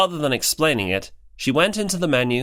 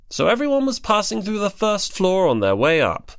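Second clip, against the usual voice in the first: plain reading voice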